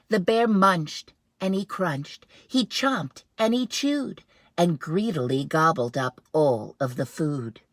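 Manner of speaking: read-aloud with gusto; storybook narration. Acted. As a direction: relish every munch. Dramatic picture-book narration.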